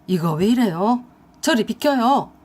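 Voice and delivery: a lightly voiced man fending her off; alarmed boyfriend. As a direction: shove her off in a panic. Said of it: "칠겁을 하면서 남친이 그 여자에게 그랬어요".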